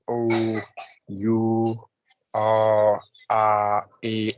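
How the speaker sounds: "O, U, R, R, A" as separate held vowels, oh, you, aw, ah, ay; isolated vowel sounds. Shown as a demonstration. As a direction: sound out "O, U, R, R, A" one vowel at a time with pauses. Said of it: "try to practice it".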